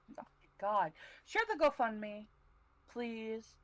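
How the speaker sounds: ordinary talk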